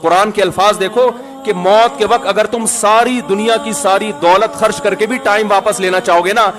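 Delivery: ordinary talk, nothing marked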